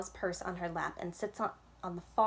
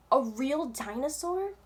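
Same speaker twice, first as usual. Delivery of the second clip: slow, high, awed question, louder; excited; 7 semitones higher, 6 dB louder, slower than usual. A narrator's reading. Vic grabbing Jax's arm over the dinosaur, excited. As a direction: stretch it out in rising amazement. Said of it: "I understand Vic's excitement".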